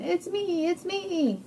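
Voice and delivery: high-pitched happy reveal; excited hen. Acted. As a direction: pipe up high as the unmasked hen.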